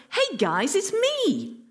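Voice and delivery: high, sing-out "it's me"; chirpy mock hail to pals, pitch leaping up; casual greeting. Jesus casually hailing the disciples, breezy call-out. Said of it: "Most straightforwardly, it's the sort of".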